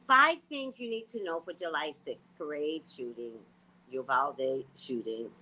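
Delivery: ordinary talk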